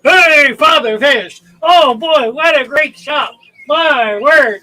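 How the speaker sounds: loud, high over-the-top cheer; cocky excited teen. Retold or imitated. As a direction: burst in full of himself. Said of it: "full of himself".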